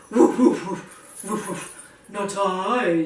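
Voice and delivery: woofs fading to breathy; barking dog. Acted. Dog answers the hen, barking woofs. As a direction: bark then refuse. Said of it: "said the dog".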